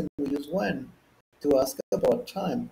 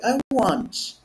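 higher, emphatic "I want"; impolite demand; 9 semitones higher than usual. Shown as a demonstration. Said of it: "whenever we want to be polite, we don't say".

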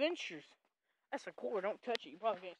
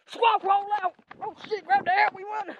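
loud high rallying yell; much louder, higher shouted rallying cry; 11 semitones higher, 15 dB louder than usual. Real, not acted. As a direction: call the squad to run. Rallying the animals to run.

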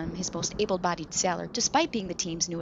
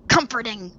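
sarcastic snarl; 5 semitones higher, 11 dB louder than usual. Acted. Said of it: "Flynn snarled".

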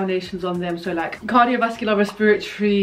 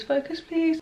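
higher pleading plea; 8 semitones higher, 3 dB quieter than usual. Real, not acted. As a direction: beg the camera, pitched up. Begging camera to focus.

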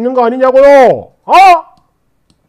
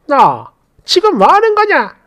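higher-pitched mock astonishment; startled; 5 semitones higher than usual. Mother-in-law taken aback by daughter-in-law's casual talk, startled. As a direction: act taken aback and affronted. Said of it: "깜짝 놀라며".

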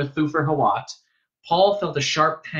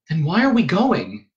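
higher-pitched insistent question; boy's pointed demand; 6 semitones higher, 4 dB louder than usual. A narrator's reading. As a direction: press for an answer. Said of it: "Paul demanded".

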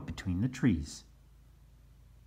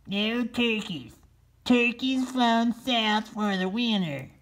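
high, slow put-on voice; sign read in character; 14 semitones higher, 3 dB louder, slower than usual. Turkey sign read aloud to the pilgrims in high put-on voice. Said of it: "He put up a big sign".

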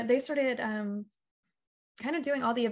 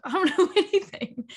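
laughed out, higher and louder; laughing; 8 semitones higher, 7 dB louder than usual. Retold or imitated. Telling the host about her fear of looking clueless, laughing. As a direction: giggle through the admission.

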